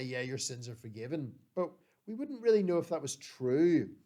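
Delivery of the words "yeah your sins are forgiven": low, soft, throwaway delivery; casual, under-played pronouncement; offhand claim. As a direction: toss it off as empty words. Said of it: "anybody in one way could just say".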